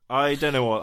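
normal talking voice

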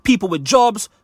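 booming circus ringmaster intro. Acted. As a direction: announce it like a big top showman. Podcast opener, ringmaster-style announcement.

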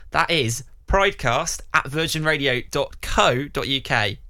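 usual speaking voice

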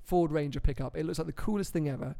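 usual speaking voice

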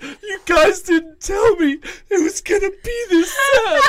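hammed-up tearful whine; mock crying complaint. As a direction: imitate a friend blubbering. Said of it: "He's like".

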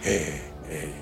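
low voice imitating an echo; echo effect. Genie's voice echoing, imitated low and fading. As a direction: fade it out like a cave echo. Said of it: "porque había eco".

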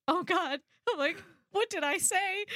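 high, nervous mock panic. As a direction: act scared of the big girl. Scared teen self.

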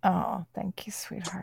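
very soft, whispered thanks; near-whisper, touched by the praise; hushed thanks. Real, not acted. Host thanking a tearful guest for her praise, hushed.

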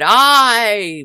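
loud, high, long-held shout; great voice. A narrator's reading. Merry men all answering Robin, great hearty shout. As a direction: shout assent as a whole band would. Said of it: "with a great voice".

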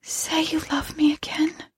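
high, soft whispery begging; a faint, hollow plea from the dead; thin, monotonous ghost voice. Acted. Dead wife begs husband's love, thin and monotonous. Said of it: "the thin, monotonous voice went on".

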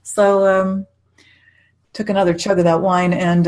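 ordinary talk, nothing marked

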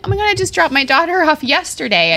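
high, gushing mock confession; retold emotional outburst. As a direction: blurt it to a stranger, worked up. Retold tearful confession to stranger.

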